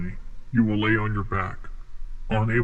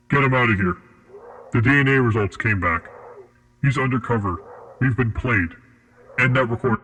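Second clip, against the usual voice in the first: loud, rushed orders as the plan collapses; urgent alarm; 2 semitones higher, 5 dB louder than usual. Acted. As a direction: bark it out, everything's gone wrong. Agent discovers he's undercover.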